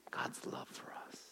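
real whisper, barely audible; reverent whisper. Real, not acted. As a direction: let it fade to pure breath. Reverent close of the point.

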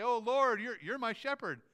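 high, loud appeal to the Lord; pleading sinner. Acted. Hardened sinners addressing Jesus at judgment, high pleading cry. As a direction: cry out to the Lord, pitched well up. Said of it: "come and say".

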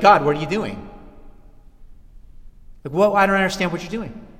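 mildly indignant questioning of God; acted protest. Preacher voicing believer's reaction to trouble, mock-baffled protest. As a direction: play a baffled complaint, fairly loud. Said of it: "your immediate reaction is gonna be like".

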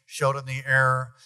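ordinary talk

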